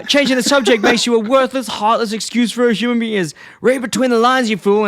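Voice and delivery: high-pitched, loud imitation of scolding; mock outraged rebuke. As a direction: berate the fool, hammed-up and high. Retold insulting rebuke of Homer.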